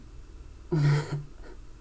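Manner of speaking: mostly voiceless chuckle; laugh. Real, not acted. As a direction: laugh quietly to yourself after a blunt remark. Laughs after blunt dismissal.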